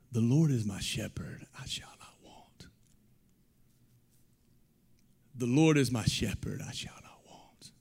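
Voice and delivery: breathy whispered psalm. Retold or imitated. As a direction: whisper it close into an ear. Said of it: "And this is what he says".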